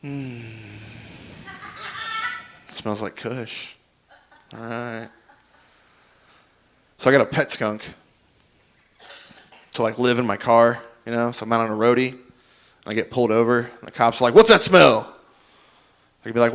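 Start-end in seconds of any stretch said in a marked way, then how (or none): 0.0-5.1 soft, slow, pleased musing; quiet stoned approval; stoner appreciation. Retold or imitated. Stoner savours the skunk smell. 14.3-15.2 loud, higher officer's demand; imitating a suspicious cop. Cop at traffic stop, demanding.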